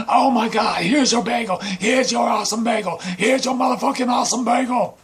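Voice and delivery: high-pitched, full-volume mock rage; furious shouting. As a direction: scream each line at the victim, hammed up. Attacker yells while force-feeding the bagel.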